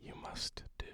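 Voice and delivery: ordinary talk, nothing marked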